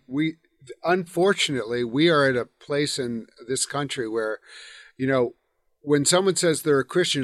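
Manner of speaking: ordinary talk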